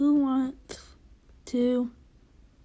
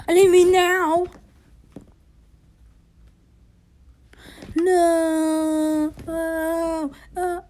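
much higher, louder panicked outburst; 7 semitones higher, 7 dB louder, much slower than usual. Real, not acted. Gamer panicking as his character dies. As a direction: yelp it as death comes too soon.

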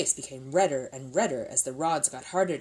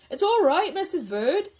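high, loud little bear voice; raised, high-pitched reassurance; Paddington; 7 dB louder than usual. Acted. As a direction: call out reassuringly from a doorway. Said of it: "somewhat muffled voice from the dining-room doorway".

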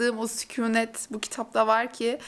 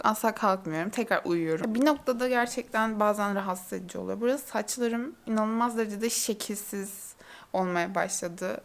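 normal talking voice

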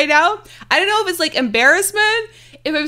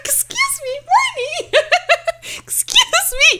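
high, squeaky giggling protest; flustered, laughing scolding; laughing mock outrage; 13 semitones higher, much slower than usual. Real, not acted. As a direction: protest through giggles, pitched way up. Mock outrage at viewer's flirty comment.

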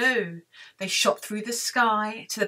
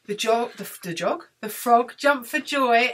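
ordinary delivery

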